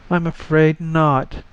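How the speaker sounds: a gently mournful answer; infinite sadness. Acted. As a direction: let the sorrow show a little. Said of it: "with infinite sadness".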